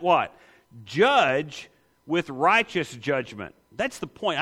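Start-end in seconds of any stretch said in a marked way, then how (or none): 0.8-3.5 slow, weighty reading of the quote; slow emphasis. Acted. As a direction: lean on every word, slowly. Preacher answers his own question.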